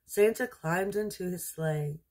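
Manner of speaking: ordinary delivery, nothing marked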